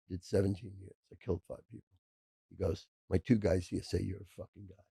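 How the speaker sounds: softly voiced tough guy from the mob, hinted at; hushed mobster. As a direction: murmur it like a quiet gangster bragging. Retold mobster boasting to him.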